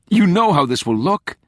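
high, loud, heated objection; alarmed, forceful protest; urgent warning. A narrator's reading. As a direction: confront your father urgently. Daughter warns father in argument.

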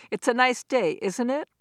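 rising tune on "isn't it"; tag question. Shown as a demonstration. Lecturer giving an example tag question, rising on "isn't it". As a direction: say "It's a nice day, isn't it?" lifting at the end. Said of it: "that would be something like this".

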